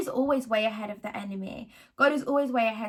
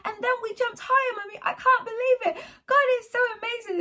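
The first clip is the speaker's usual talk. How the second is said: put-on high, thrilled child's voice with a tearful catch; excited young son; 9 semitones higher than usual. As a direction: gush like an amazed little boy, much higher. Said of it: "Or he'd say".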